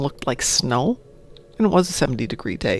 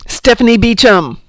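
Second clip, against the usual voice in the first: loud, raised burst of recognition; triumphant exclamation; 6 semitones higher, 9 dB louder than usual. Real, not acted. Streamer finally places the actress's voice, triumphant exclamation. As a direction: call the name out high and loud. Suddenly recalls the actress's name.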